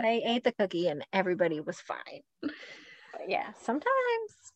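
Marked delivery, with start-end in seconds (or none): 2.4-3.5 breathy whispered but yeah; whispered aside. Real, not acted. Mom whispers a sly aside, whispered. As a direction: drop to a whisper.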